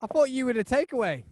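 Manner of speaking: high, loud retold surprise; imitated surprise. Customer mistaking friend for takeaway driver, imitated surprise. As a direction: act out the startled mistaken customer. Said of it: "And he said".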